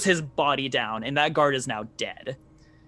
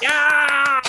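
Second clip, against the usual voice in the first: excited cheer; 11 semitones higher, 9 dB louder than usual. Real, not acted. GM cheers the kill.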